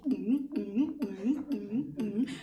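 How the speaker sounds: little beatbox hums while counting; playful hummed beat. Shown as a demonstration. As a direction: mouth beatbox rhythm. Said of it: "beatboxing at the same time".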